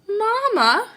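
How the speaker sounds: little sister crying out. Acted. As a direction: call out to Mama in alarm. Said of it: "cried Sister Bear".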